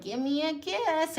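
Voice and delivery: high-pitched mock uncle wheedle; creepy old uncle. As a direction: imitate an uncle begging for affection. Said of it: "creepy old uncle who's like".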